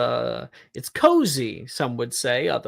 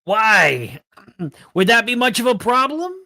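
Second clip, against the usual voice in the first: brassy gum smacking floozy; 10 semitones higher, 7 dB louder than usual. Acted. Skull flirting back in voice.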